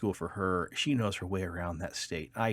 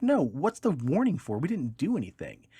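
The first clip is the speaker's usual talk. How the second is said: much higher, insistent protest; indignant wife; 6 semitones higher, 3 dB louder than usual. Retold or imitated. Wife protests to the trooper, high and indignant. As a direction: demand an answer, outraged and high.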